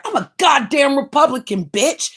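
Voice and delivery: ordinary talk, nothing marked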